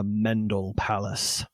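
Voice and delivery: low, ominous title reveal; dark and scary. Shown as a demonstration. Host announcing the rebranded game's title, mock-ominous. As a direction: drop low for the spooky name. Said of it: "to be darker and scarier".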